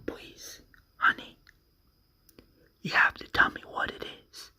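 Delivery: hushed, desperate plea; a hushed plea for the truth; pleading whisper. Retold or imitated. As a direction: whisper it, begging. Pleading quote in retold scene.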